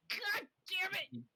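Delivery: frustrated curse. Real, not acted. Bad roll in game.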